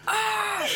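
small high-pitched psych-up yell; half-volume imitation of a stuntman's shriek; mock scream. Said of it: "the scream is what he needed".

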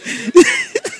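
high burst of real laughter. Real, not acted. Laughing at friend's fate.

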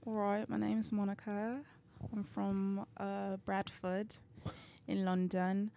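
soft, measured put-on British accent. Shown as a demonstration. Guest demonstrates her British accent for the hosts, British accent. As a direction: introduce yourself in a careful British accent. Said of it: "let me hear the British accent".

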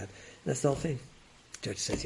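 ordinary talk

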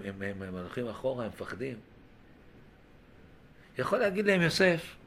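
normal talking voice

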